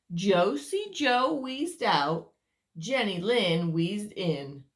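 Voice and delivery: lively read-aloud voice for little ones; storyteller reading to children; story narration. A narrator's reading. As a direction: tell it like a bedtime picture book. Reads picture book aloud.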